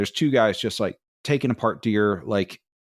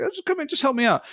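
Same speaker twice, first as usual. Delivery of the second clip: much higher, fast imitation of a hunter; coaxing hunter; faster than usual. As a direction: coax him in a squeaky, fast voice.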